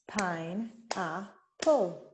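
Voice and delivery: Pine-a-pull said very slowly, one beat per syllable; syllables clapped out. Shown as a demonstration. Teacher counting syllables for students, very slow. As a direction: stretch each beat of Pine-a-pull apart. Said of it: "how many syllables are there in the word".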